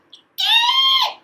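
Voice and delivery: very high loud shrieking call; peacock cry. Retold or imitated. Storyteller imitating peacock for kids, piercing shriek. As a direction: screech it like a peacock. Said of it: "The peacock will go".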